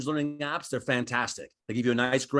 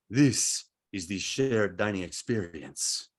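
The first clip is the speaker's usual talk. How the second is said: slow, low waiter voice; mock-solemn waiter's announcement; portentous waiter; 6 semitones lower, slower than usual. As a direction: present it slowly and grandly, pitched down. Waiter grandly presents the tapas concept.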